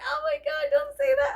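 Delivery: high, loud flustered squeal. Retold or imitated. To her husband after he says she deserves it: a flustered squeal. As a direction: squeal like you're blushing.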